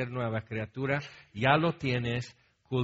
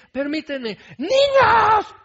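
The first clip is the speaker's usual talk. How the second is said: put-on much higher and louder, snapping at the children; mom yells at kids; 10 dB louder, slower than usual. Mom pausing the phone call to yell at the kids. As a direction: cut off the call to bark at the kids.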